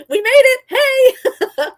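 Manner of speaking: high, loud laughing cheer; mock celebration. Storyteller joking about surviving the attack, high laughing cheer.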